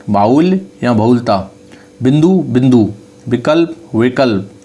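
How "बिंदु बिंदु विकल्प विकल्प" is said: usual speaking voice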